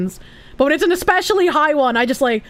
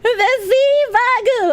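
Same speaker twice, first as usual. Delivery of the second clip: silly high voice; 11 semitones higher, slower than usual. Pretend. Reading a name in a silly voice.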